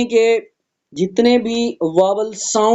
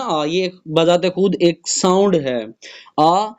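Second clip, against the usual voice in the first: usual speaking voice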